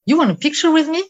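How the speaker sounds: high, loud, bright offer of a photo; eager offer in English. Retold or imitated. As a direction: pitch it up and out, friendly and sudden.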